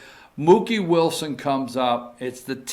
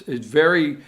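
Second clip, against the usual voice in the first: ordinary delivery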